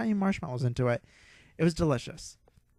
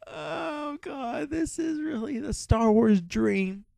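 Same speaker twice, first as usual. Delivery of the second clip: near-tearful cry of delight, much higher; choked-up, ecstatic shout; overwhelmed joy; 12 semitones higher, slower than usual. Real, not acted. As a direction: gush like a fan whose dream came true. Overwhelmed fan at Star Wars bar.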